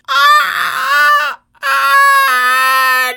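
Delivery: loud, high held "ah"; failed attempt at singing a high A; straining high note. Shown as a demonstration. Festival-goer trying the high A, straining. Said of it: "try to sing the high A".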